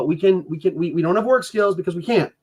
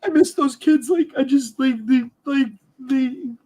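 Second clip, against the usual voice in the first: crying; 6 semitones higher than usual. Real, not acted. Father crying over the children he can't see. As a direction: voice breaking, high and tearful. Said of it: "Sorry for crying".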